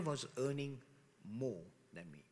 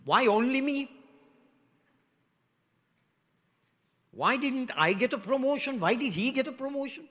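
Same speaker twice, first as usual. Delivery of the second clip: higher, louder self-pitying complaint; whiny victim; 8 semitones higher, 6 dB louder than usual. Acted. Church member complaining about promotion, loud whiny grumble. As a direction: moan like you were cheated of a promotion.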